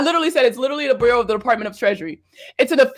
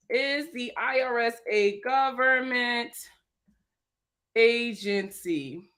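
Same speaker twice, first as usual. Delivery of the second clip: sarcastic question; 6 dB quieter, much slower than usual. Acted. Sarcastic rhetorical question to commenter.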